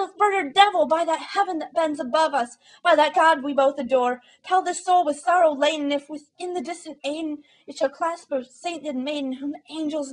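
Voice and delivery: high, loud frantic plea; desperate pleading. A narrator's reading. Narrator frantically begging the raven for an answer. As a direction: beg the bird with rising desperation. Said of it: "said I".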